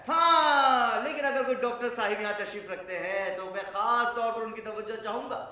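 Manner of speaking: high, loud burst of "yes", then insistent address; sudden loud appeal. Acted. Lecturer demanding doctors' special attention, loud and excited. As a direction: call out to the whole hall, emphatically.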